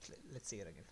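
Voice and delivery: normal talking voice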